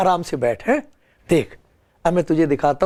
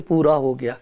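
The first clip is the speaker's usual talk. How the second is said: said with love; 6 semitones lower than usual. Retold or imitated. Mourners lovingly speaking of the dead, tender and low. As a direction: say "پورا ہو گیا" gently, lower, like a loving farewell. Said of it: "بڑے پیار سے کہتے ہیں".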